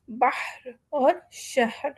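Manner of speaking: slow, careful بحر and شہر; contrasting ha sounds. Shown as a demonstration. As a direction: draw out بحر and شہر to show the two h sounds. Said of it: "ان دونوں میں فرق ہے".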